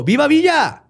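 high, loud cheer of allegiance; retold battle cry, pitched well up; imitated rallying shout. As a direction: cry it out like a lookout's signal. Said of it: "gritó".

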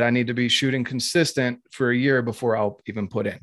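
usual speaking voice

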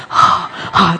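whispered mock gasps of an intubated patient; breathy imitation of someone who can't breathe; gasping patient. Wife picturing intubated hospital patients, whispered gasps. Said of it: "都呼吸不过来".

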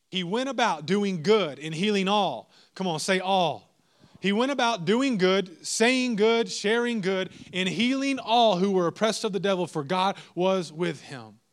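rising sermon fervor; building preacher cry. Acted. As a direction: drive it home to the church. Preaching at full force.

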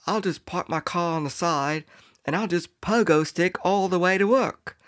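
high, put-on British voice; mock British accent. European commuter explaining his pogo plan, mock British accent. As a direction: play a breezy Brit in a silly high voice. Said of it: "it was in Europe".

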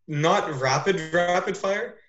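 usual speaking voice

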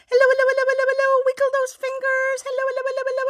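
rapid, high, bright hellos; fast hellos. Shown as a demonstration. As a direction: rattle off the hellos quickly. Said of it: "as fast as we can".

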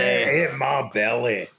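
low, slow Scottish glutton voice; Fat Bastard. Acted. Repeating the film quote in a slow mock Scottish voice. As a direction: drag it out like a greedy Scottish villain. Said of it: "you sound like fat bastard".

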